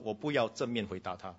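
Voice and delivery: usual speaking voice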